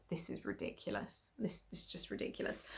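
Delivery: whispered, disbelieving mutter; exasperated whisper. Retold or imitated. Reacting to the fees Etsy charged, whispered disbelief. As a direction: breathe it out in quiet disbelief.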